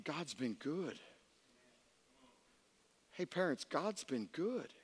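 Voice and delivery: hushed, low, heartfelt; quiet gratitude. Real, not acted. Preacher gently reminding parents, grateful and hushed. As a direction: say it softly, letting it sink in.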